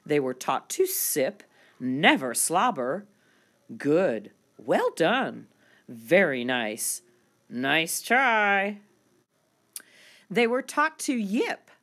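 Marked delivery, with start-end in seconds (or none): none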